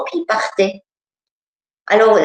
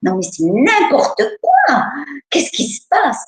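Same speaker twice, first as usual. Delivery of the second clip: alarmed; 6 semitones higher than usual. Retold or imitated. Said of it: "Puis moi".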